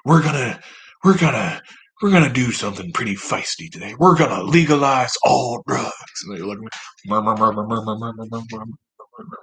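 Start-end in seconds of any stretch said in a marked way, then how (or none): 0.0-5.9 put-on Southern accent. Benefactor addressing bought senators, put-on Southern accent. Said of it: "a, a Southern accent". 7.0-9.4 monotone mumbled mur-mur-mur, fading; imitated crowd murmur. Senators murmuring at their benefactor, softening mock mumble.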